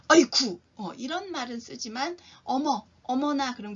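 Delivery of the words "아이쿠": loud, sharp startled yelp on "아이쿠"; man's startled cry. Shown as a demonstration. Teacher showing how men cry out when startled. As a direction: yelp "아이쿠" like a man caught off guard. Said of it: "남자들은 놀랐을 때".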